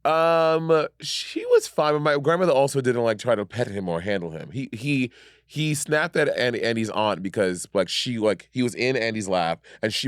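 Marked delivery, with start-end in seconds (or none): none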